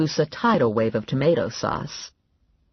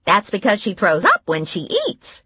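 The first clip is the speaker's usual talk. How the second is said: Grandma voice; 7 semitones higher, 4 dB louder than usual. A narrator's reading. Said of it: "Grandma said".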